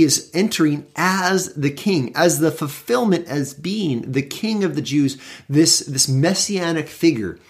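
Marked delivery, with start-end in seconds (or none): none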